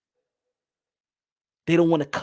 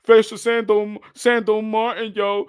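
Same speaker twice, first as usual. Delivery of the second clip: mocking critics; 4 semitones higher, 7 dB louder than usual. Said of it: "Even when people be like".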